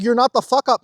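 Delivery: fired-up correction; emphatic reassurance. Real, not acted. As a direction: push back hard on it.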